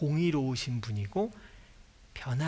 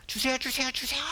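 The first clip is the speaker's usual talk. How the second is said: put-on much higher childish pleading "주세요"; whiny begging; 10 semitones higher than usual. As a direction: beg like a nagging child. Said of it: "그냥 조르는 게".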